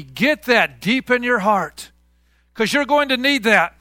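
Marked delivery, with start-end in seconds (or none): none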